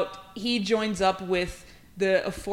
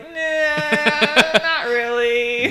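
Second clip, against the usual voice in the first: dismissive nah; 5 semitones higher, 6 dB louder, much slower than usual. Retold or imitated. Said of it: "And I was like".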